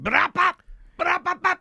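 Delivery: high, loud mock exclamation; playful shouted echo. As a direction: shout the funny name like a sound effect. Playfully shouting the pepper's name.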